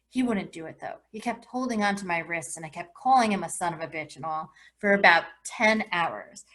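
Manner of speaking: plain reading voice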